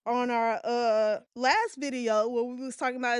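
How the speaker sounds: ordinary talk, nothing marked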